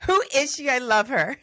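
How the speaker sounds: loud, high burst of delight; real delighted outburst. Real, not acted. Host interrupting the guest's story about her blunt friend, delighted outburst. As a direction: cut in loudly, thrilled and amused.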